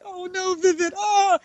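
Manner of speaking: high, soft mock wail; a tiny high-pitched oh-no, played for comedy; mock dismay. Mock refusal of a come-on.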